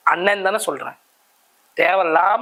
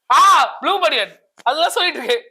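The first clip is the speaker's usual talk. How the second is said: mock realisation; 6 semitones higher, 4 dB louder than usual. Mock realisation about the vest.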